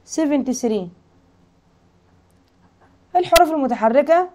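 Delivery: normal talking voice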